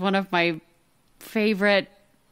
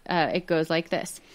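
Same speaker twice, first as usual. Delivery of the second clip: ordinary delivery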